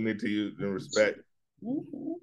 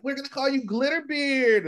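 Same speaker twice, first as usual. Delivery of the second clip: put-on excited young ravers. Twenty-year-olds nicknaming him at a rave, mock high-pitched excitement. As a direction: imitate giddy youngsters way up high.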